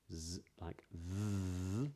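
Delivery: "z" and "th" long, slow and buzzing; voiced buzz. Shown as a demonstration. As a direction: draw out "z" and "th" buzzing. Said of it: "the voiced T H".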